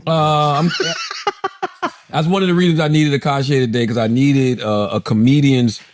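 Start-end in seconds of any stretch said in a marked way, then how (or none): 0.7-1.9 high, quick chuckle; a short burst of high laughter; real laugh. Real, not acted. Host opening the Jimmy Kimmel topic, high laugh.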